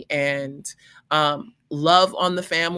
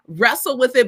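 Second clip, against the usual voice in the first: normal talking voice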